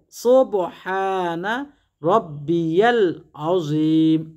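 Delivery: each Arabic syllable drawn out very slowly, a measured chant; slow careful recitation. Shown as a demonstration. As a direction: recite the prayer slowly, syllable by syllable, as a model to copy. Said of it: "এভাবে তিনবার পড়বেন".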